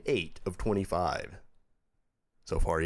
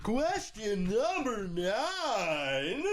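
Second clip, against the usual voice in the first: game show host; 13 semitones higher, much slower than usual. Acted. Quiz host reading next question.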